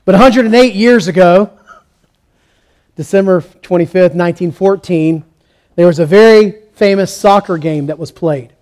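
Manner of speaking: normal talking voice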